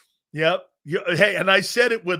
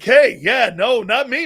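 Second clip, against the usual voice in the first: loud raised protest; loud mock denial; 5 semitones higher, 9 dB louder than usual. Loudly denying to his teammates with a laugh. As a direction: loudly insist it wasn't you.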